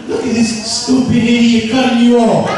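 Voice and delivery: sneering, sing-song tempter voice; singsong devil mocking the other driver; Satan's road-rage voice. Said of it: "Hasatan voice be sounding loud".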